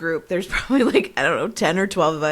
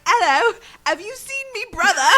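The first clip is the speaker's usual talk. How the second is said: put-on best British accent; 13 semitones higher than usual. Sister hunting for her brother in the men's room, mock British accent. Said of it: "put on my best British accent".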